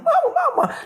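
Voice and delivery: high wailing cry of mama with a laugh; a child's shrill call for mother; kids hollering. Retold or imitated. Children in the van hollering for mama, very high. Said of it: "we all went hollering".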